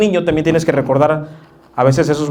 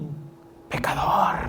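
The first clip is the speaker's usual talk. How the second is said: mock judgmental gasp; 9 dB quieter than usual. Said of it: "todos voltean y".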